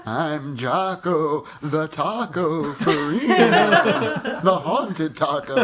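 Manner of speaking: slow, loud laughing spooky voice. Acted. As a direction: ham it up like a haunted monster. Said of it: "Everyone's gotta say it in a spooky voice".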